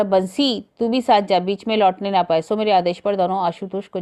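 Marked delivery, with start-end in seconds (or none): 0.0-2.4 loud summons, raised voice; calling out. A narrator's reading. Narrator calling Bansi to go along too, loudly calling out. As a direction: call out an order to a servant. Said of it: "मैंने पुकारा".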